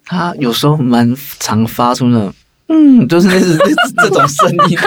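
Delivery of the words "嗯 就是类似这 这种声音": high squeaky puppy whine, half laughing; cutesy whiny pleading. Retold or imitated. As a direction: whine high and babyish like a spoiled little dog. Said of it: "还要模仿". (模仿小狗撒娇的高音奶声)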